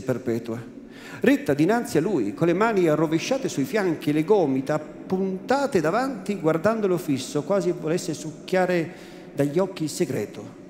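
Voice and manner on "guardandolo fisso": ordinary delivery, nothing marked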